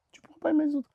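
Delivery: urgent whisper. Real, not acted. Preacher whispers the warning to the congregation. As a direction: drop to an intense whisper.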